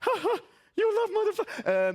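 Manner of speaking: high, fast taunting cackle voice; mocking the BMW drivers with a laugh. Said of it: "I'm laughing at them".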